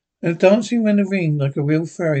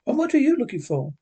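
higher, quicker echoed question; lightly voiced girl butting in; girl interrupting; 10 semitones higher, faster than usual. A narrator's reading. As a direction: cut in with a high, fast question. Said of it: "the girl interrupted".

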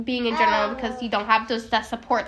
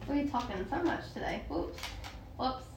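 quiet aside; 15 dB quieter than usual. Real, not acted. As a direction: drop the voice for an aside. Aside to a child, off mic.

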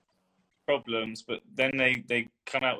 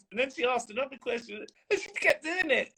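rising excitement; 11 semitones higher than usual. Retold or imitated. Excited retelling, climbing pitch.

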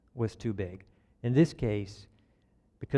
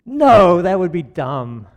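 loud, high mock-scolding 'No', then drawn out; dismissive other voice; 6 semitones higher, 12 dB louder, slower than usual. Preacher voicing the inner voice against helping, loudly dismissive. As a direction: blurt it like an overruling inner critic. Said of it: "then you hear that other voice".

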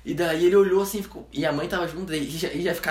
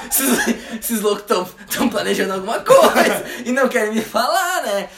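desconfiado rindo; 5 semitones higher, 6 dB louder than usual. Retold or imitated.

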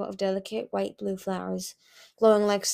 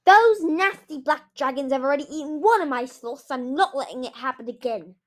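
loud, raised outburst, easing off; shouted; 5 semitones higher, 6 dB louder than usual. A narrator's reading. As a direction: protest angrily and protectively. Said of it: "she shouted".

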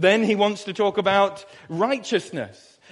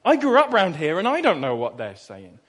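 thick Suffolk mumble; 2 semitones higher than usual. Retold or imitated. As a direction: mumble like marbles in your mouth, Suffolk. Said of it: "mouthful of marbles with the Suffolk accent".

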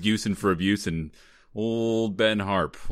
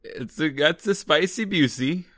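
slow, higher mock-Italian accent; Italian Borat; 6 semitones higher than usual. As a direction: ham up a silly Italian accent. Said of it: "I'm like Italian Borat".

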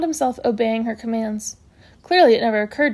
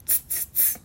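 three soft tuts; disapproving tutting; 17 dB quieter than usual. A narrator's reading. Matron facing a knife unalarmed, soft tutting. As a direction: tut as at a naughty child. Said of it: "wagged her finger at him".

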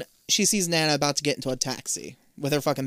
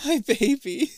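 high, retold motherly heartbreak; imitated tearful tenderness; 9 semitones higher, slower than usual. Mother sees her ghost daughter, imitated tearful tenderness. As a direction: act near tears, without crying. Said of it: "actually about to break down in tears".

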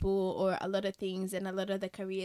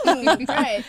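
laughing mock delight; 4 semitones higher, 11 dB louder than usual. Mock-pleased reaction, laughing.

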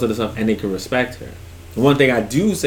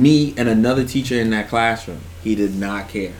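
ordinary delivery

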